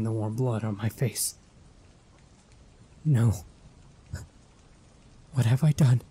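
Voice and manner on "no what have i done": loud anguished outcry; horrified anguish. Acted. Mother after stabbing her son, anguished cry. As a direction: horror breaking through.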